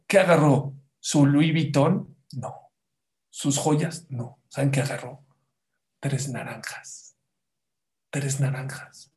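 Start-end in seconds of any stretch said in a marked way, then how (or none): 6.0-7.2 susurro. Shown as a demonstration. Remate del relato, susurrado.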